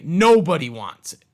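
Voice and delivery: usual speaking voice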